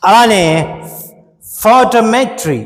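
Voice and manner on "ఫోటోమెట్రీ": ఫోటోమెట్రీ with the weight on మె; stress on met. Shown as a demonstration. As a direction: lean into మె in ఫోటోమెట్రీ. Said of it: "మెట్ మీద ... వస్తున్నది stress". (మెట్ మీద ఒత్తిడితో ఫోటోమెట్రీ)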